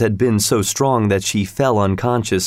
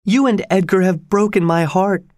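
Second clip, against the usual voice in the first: high, wounded accusation; heartbroken reproach; 9 semitones higher than usual. A narrator's reading. Dying woman blames her lover, heartbroken. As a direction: lament it well above your usual pitch.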